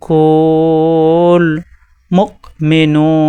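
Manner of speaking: কুল drawn out very long in a chanted recitation tone; Quranic recitation. Shown as a demonstration. Teacher demonstrating recitation to the class, slow chanted Arabic. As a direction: recite মুকমিনুন slowly, holding the hamza firm. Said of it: "এভাবে পড়তে হয়".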